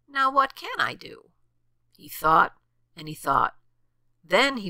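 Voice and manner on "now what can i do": high, lightly voiced puzzled rabbit; pondering rabbit. A narrator's reading. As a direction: wonder aloud in a higher voice. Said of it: "thought the rabbit".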